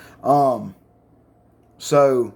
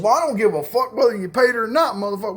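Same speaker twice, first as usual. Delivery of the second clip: higher-pitched mock outrage; indignant retort; 7 semitones higher than usual. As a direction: fire back as an offended friend. Friend retorts in an argument.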